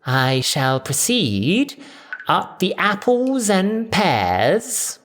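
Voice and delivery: very slow, posh phrasing faking Cockney slang; hammed-up Cockney. A narrator's reading. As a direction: lay the slang on thick. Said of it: "troweling on the slang".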